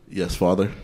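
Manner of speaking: dropped low, mock-proper reply to his father; low mock-formal son. Son in the bunk bed answering his dad, low and mock-formal. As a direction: stiff little "Father" in a low voice.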